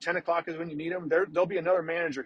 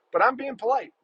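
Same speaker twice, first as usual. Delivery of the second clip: usual speaking voice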